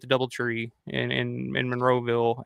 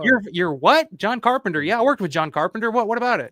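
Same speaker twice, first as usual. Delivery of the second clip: higher-pitched retold bewilderment; baffled old man; 6 semitones higher than usual. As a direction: imitate someone who has misheard, firing back questions. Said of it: "he can't hardly hear shit".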